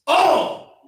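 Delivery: "All" pitched high and punched out; stress on All. Shown as a demonstration. Preacher driving home to the congregation that nothing is left out, emphatic "All". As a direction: hit "All" hard as one emphatic word. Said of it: "When we say all, not something".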